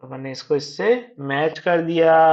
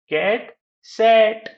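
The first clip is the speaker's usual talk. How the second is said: cat, pause, sat, pitched higher; spaced-out words for learners; reading out; 6 semitones higher, 3 dB louder than usual. Shown as a demonstration. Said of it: "read out करना है".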